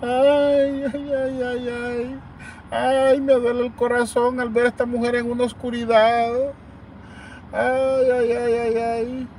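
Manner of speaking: ay ay ay fingido y más agudo; lamento burlón. Retold or imitated. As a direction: exagera el quejido como parodia. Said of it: "unos audios falsos". (parodia los audios falsos con quejido agudo y burlón)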